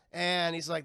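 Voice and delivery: ordinary talk, nothing marked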